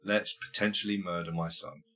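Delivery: husband giving in to wife, softly deadpan; resigned husband. Retold or imitated. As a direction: mutter the surrender lazily. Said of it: "then he's like".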